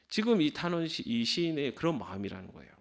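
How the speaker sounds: normal talking voice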